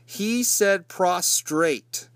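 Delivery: prostrate dragged out slowly, each syllable leaned on; slow mocking emphasis on prostrate; slow stressed prostrate. Shown as a demonstration. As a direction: savour the word prostrate in disbelief. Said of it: "And yes".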